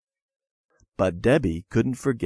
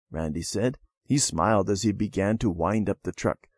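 plain reading voice

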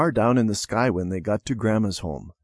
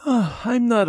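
sleepy yawn; 12 semitones higher than usual. Acted. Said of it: "Debbie gave a big yawn".

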